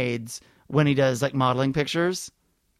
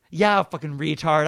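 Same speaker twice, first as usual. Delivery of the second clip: usual speaking voice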